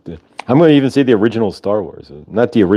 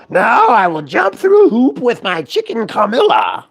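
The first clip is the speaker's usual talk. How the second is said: high, slow Muppet Gonzo; 13 semitones higher, slower than usual. Retold or imitated. Introducing Gonzo to the group, high slow Muppet voice. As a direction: announce the stunt like Gonzo. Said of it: "And of course we have Gonzo".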